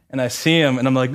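usual speaking voice

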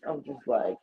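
normal talking voice

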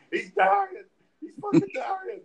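very high, loud panic; hammed-up shrieked alarm; mock frantic yell. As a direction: cry out hysterically to the nurse. Retold outburst at the nurse.

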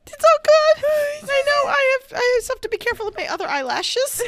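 high laughing words; crying with laughter. Real, not acted. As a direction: talk through tears of laughter. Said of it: "you're crying".

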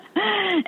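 a short high-pitched giggle; breathless high laugh. Real, not acted. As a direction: giggle it out, caught up in the memory. Laughing while recalling the race.

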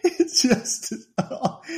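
helpless laugh breaking up slow, high words; cracking up. Real, not acted. Host cracks up recalling the sandwich gag, laughing. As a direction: squeeze words out through laughter.

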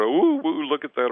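mock the people who want ghost thrills; mock spooked thrill. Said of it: "there for entertainment or to scare us".

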